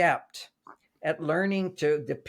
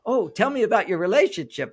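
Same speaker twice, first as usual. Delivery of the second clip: higher, louder eager mock gush; co-hosts gushing over a guest's perfect relationship; excited chorus; 6 semitones higher, 5 dB louder than usual. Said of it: "They're all excited".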